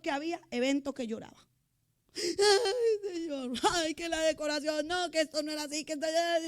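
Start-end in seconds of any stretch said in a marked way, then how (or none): 2.2-6.4 high, crying complaint in a retold voice; mock crying, higher pitched; tearful whimper. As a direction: whimper it like she's about to cry. Retelling her own tearful complaint.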